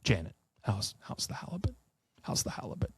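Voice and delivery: hushed, half-whispered Australian attempt with a Fargo slip; botched Australian accent. Retrying the question to Janet, hushed botched Australian accent. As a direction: practise a shaky Australian accent under your breath. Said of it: "my Australian has a Fargo accent".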